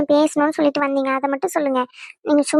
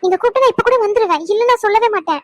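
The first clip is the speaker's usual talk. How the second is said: higher, louder anguished plea; pained wife protesting loudly to her husband; distressed protest; 5 semitones higher, 3 dB louder than usual. Acted. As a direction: cry out that you could never hate him.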